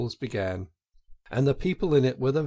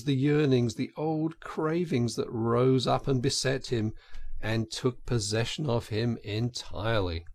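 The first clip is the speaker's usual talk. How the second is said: ordinary delivery, nothing marked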